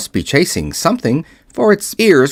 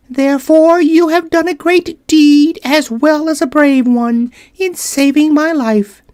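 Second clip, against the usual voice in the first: high, tiny voice with royal pride; a little queen drawing herself up; proud mouse queen; 3 dB louder than usual. Acted.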